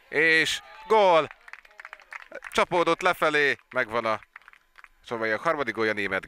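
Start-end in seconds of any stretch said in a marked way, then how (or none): 0.1-3.6 loud, high burst on the goal; excited hungarian sports commentary. Real, not acted. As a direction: burst out as it hits the net. Live goal commentary, goal scored.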